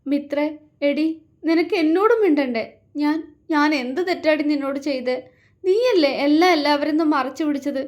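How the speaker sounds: sister-in-law crying out her grievances to Mithra; crying reproach. A narrator's reading. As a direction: pour out the hurt while crying. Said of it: "കരഞ്ഞുകൊണ്ടാണ് അത്രയും പറഞ്ഞത്".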